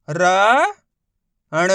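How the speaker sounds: र and ण held long, one by one; drawn-out letter drill on र and ण; sounding out letters. Shown as a demonstration. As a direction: stretch र, pause, then ण. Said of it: "एक ही अक्षर का उच्चारण करके".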